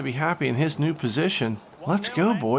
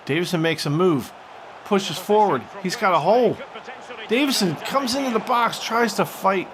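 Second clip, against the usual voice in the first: ordinary delivery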